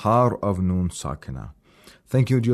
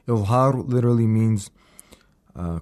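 ordinary talk, nothing marked

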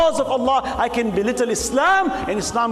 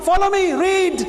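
fiery preaching; 7 semitones higher than usual. Acted. The preacher rails against stories that belittle the Prophet, shouting. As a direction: hammer the point home to the crowd.

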